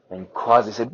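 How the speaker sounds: usual speaking voice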